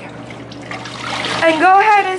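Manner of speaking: mock store announcer over the PA; manager on speaker. Retelling the store manager's overhead announcement, mock announcer voice. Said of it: "the manager is like".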